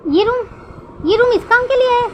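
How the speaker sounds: stunned at the sight of the room; wide-eyed shock, voice jumping up; astonished. A narrator's reading. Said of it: "wing की आंखें बड़ी-बड़ी हो जाती".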